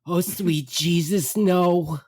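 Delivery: loud, high, drawn-out mock wail; distressed mother. Mother behind the group on the wharf, distressed. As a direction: imitate a mother in comic agony. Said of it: "from behind all here is".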